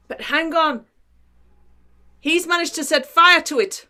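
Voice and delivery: put-on leprechaun brogue; Irish accent. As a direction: interrupt in an amateur Irish accent. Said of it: "do the accent that I can't do".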